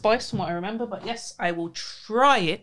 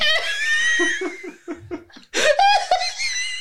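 shrieking high laughter; helpless high laugh; wild laughing. Real, not acted. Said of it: "we've lost her".

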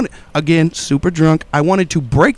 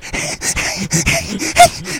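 imitated huffing; 4 dB quieter than usual. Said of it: "I'm huffing and puffing. I sound like".

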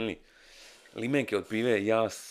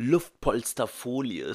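German pronunciation; 4 semitones higher, 7 dB louder, much slower than usual. Shown as a demonstration. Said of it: "kako se kaže na njemačkom".

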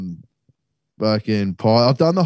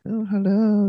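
high-pitched mock "oh, hello"; flirty women greeting; 9 semitones higher than usual. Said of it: "six chicks lined up".

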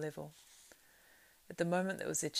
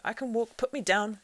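gasping; 6 semitones higher, 8 dB louder than usual. A narrator's reading. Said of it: "gasped Frodo".

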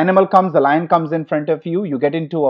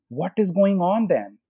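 usual speaking voice

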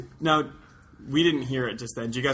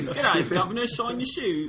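higher-pitched, hammed-up English accent; bad English accent; 9 semitones higher than usual. Host trying an accent for his Australian co-hosts, bad English accent. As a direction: attempt a mock English accent that slips towards Australian. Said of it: "go into an English accent".

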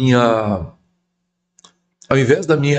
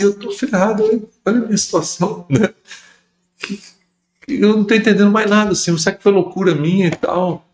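higher, fast, rushed plea to God; desperate pleading prayer; 8 semitones higher than usual. Retold or imitated. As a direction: pour out the confusion in a hurry.

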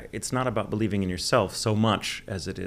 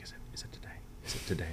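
anxious whisper; 10 dB quieter, faster than usual. Retold or imitated. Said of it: "every day I'd be like".

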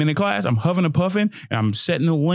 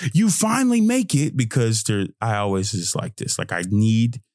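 ordinary talk, nothing marked

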